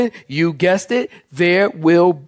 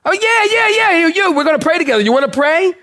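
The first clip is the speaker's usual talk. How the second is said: mock eager; 12 semitones higher, faster than usual. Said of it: "she goes".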